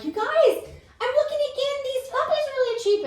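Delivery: high-pitched, breathless-with-news excitement; acted thrilled appeal, way up high; excited pleading. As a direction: pitch it to friends in an eager squeal. Retelling excited pitch to friends.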